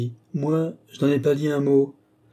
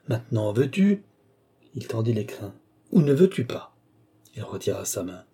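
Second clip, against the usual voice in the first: usual speaking voice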